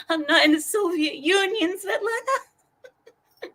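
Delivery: high-pitched tearful protest, last word drawn out; mock crying. Client protesting to Russian masseuse Svetlana, mock crying. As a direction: imitate a whimpering client through laughter. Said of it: "I start crying".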